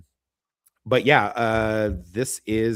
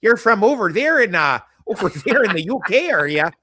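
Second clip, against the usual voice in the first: a hammed-up Midwestern local gushing at a visitor; Midwestern folksy accent; 4 semitones higher, 3 dB louder than usual. Kansas host welcoming a UK guest, in a mock Midwestern accent. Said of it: "Sorry, all my, sorry, Midwestern people".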